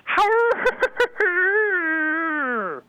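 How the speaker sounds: high howling wolf laugh; werewolf laugh. Acted. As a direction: howl it out like a werewolf. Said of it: "what about a werewolf laugh".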